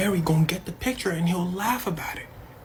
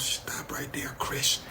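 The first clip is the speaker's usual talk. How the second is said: whispered and much softer; hushed Jerry; 4 semitones lower, 7 dB quieter, slower than usual. Retold or imitated. Jerry stopping Chris, whispered warning. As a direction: cut him off in a hush. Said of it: "Jerry goes".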